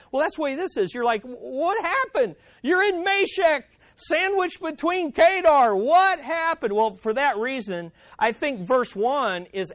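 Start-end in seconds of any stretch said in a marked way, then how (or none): 1.2-6.7 high-pitched, incredulous exclamation; hammed-up bewilderment; baffled outcry. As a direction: play the audience left hanging, demanding answers. Acted reaction to a cliffhanger ending.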